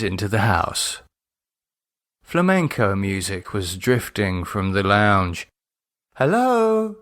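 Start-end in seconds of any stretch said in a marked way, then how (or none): 6.2-7.0 much higher calling hello. Acted. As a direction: call into the house. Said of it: "Lydia called".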